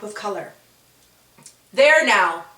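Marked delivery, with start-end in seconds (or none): 1.7-2.4 a loud, haughty proclamation; proud Ribboners. Acted. Vain Ribboners proclaiming victory, loud. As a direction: announce it like vain royalty. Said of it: "the Ribboners declared".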